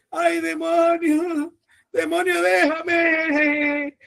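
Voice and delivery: high wailing plea, slow and drawn out; mock a weepy believer begging the demon; whiny begging. Preacher mocks a believer crying to the demon, high whiny plea. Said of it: "No se ponga a llorar".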